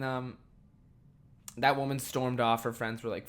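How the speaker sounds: ordinary talk, nothing marked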